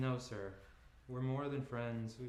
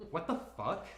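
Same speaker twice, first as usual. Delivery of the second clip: high, louder disbelieving laugh through the words; incredulous laughing curse; 6 semitones higher, 5 dB louder than usual. Acted. Ellie angry on the phone.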